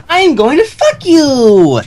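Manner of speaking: high, slow, gleeful shout; gleeful Pinkie Pie. Acted. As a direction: drag out the punchline with glee. Joke line repeated.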